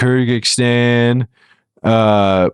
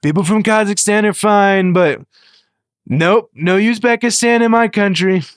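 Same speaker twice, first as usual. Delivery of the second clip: mock president voice; 6 semitones higher than usual. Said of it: "He's like".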